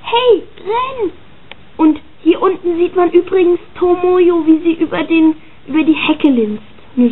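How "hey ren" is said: high bright call across the yard; mock girlish call of Ren's name. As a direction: shout his name from afar. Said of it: "und ruft so".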